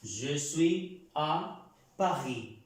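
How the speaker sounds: Je suis à Paris drawn out very slowly, final S of Paris silent; slow model pronunciation. Shown as a demonstration. Teacher drilling students, very slow silent-ending Paris. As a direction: spell it out by ear, very slowly. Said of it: "Normalmente no se pronuncian las ... últimas terminaciones".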